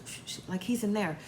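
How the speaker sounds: imitating an extra-quiet shush; hushed warning. Puppy warning Amber that Eric is in there, hushed. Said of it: "Puppy has to talk, like, extra quiet".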